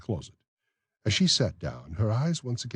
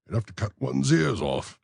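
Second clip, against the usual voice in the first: raised, fussy official's voice; pompous beadle; 7 semitones higher, 3 dB louder than usual. Acted. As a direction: play the self-important beadle. Bumble speaks.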